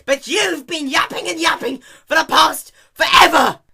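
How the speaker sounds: high, loud ranting shout; yelling about the endless yapping; angry rant. Acted. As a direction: let the frustration boil over. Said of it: "Dash continued".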